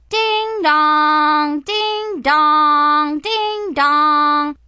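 high, loud sung ding dong; doorbell ding dong. Acted. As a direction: ring out like a doorbell. Doorbell imitated.

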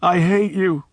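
put-on high, sobbing child's cry. A narrator's reading. Boy Miles sobbing at Charlie, high and tearful. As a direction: sob it out like a heartbroken boy. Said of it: "he sobbed".

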